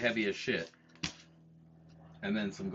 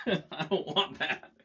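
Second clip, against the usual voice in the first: laughing; 10 semitones higher, much slower than usual. Real, not acted. Giggling while rejecting an idea.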